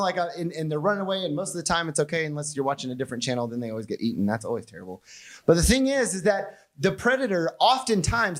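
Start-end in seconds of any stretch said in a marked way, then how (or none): none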